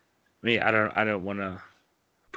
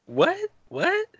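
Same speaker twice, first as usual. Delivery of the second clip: squeaky, much higher "What?"; mock confusion; 5 dB louder than usual. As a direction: play baffled, shooting the pitch way up. Mock bewilderment at the odd topic.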